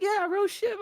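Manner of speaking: high, loud hype imitation; hammed-up cheerleading; mock egging-on. Acts out someone egging him on.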